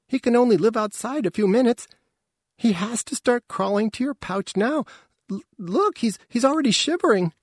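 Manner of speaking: very high, urgent pleading; high young voice in alarm; panicked youngster. A narrator's reading. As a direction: plead fast and high for the baby's life. Youngster pleads for the baby's life.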